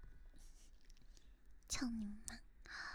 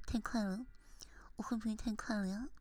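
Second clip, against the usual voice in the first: ordinary delivery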